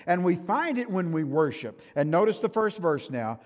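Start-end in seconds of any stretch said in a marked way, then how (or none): none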